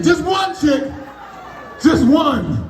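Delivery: high indignant shout back; mock indignant retort. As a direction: snap back at the old lady. Retorting to the old lady.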